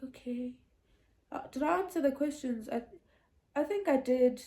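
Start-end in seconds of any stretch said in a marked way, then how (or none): 0.0-0.5 hushed, near-whispered acceptance; retold meek reply. Re-enacting being bombarded with questions by sister, meek. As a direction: let it out small, almost without voice.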